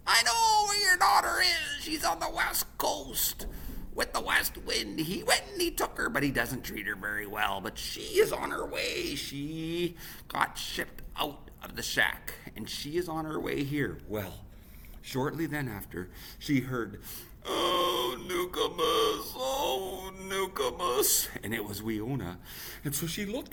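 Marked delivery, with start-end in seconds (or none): 0.1-14.0 eagle's voice. Acted. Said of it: "he said". 17.5-21.3 slow, high tearful calling; crying call. Acted. Winona arriving, crying for Nukumus, tearful. Lost daughter calling her mother.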